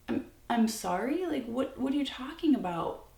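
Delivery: incredulous, pitch climbing through the question; retold indignant disbelief. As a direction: act out being taken aback. Acts out her indignant reply to boyfriend.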